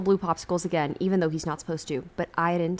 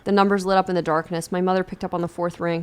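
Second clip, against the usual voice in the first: plain reading voice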